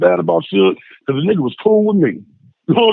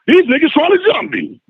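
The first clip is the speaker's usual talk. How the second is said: much higher, urgent complaint; mock alarmed protest, pitched up; retold protest; 10 semitones higher than usual. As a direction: plead your case mid-brawl. Retold argument at fight.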